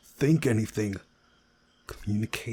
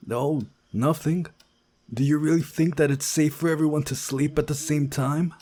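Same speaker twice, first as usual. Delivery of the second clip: higher, worried question; anxious friend; 7 semitones higher, 6 dB louder than usual. Acted. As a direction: voice a nervous buddy.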